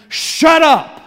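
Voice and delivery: loud, high, harsh yell; angry crowd shout. Retold or imitated. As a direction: bark it at the beggar like a hostile crowd. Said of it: "They tell him".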